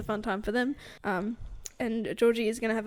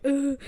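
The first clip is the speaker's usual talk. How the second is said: groaned "ugh", higher than usual; reluctant groan; 4 semitones higher, 4 dB louder than usual. Retold or imitated. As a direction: let out an exasperated mock groan. Voicing a character's reluctance.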